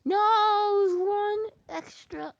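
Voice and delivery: very high, loud, drawn-out cry; anguished wail. Real, not acted. Player one short of the goal, anguished wail. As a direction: wail it in defeat.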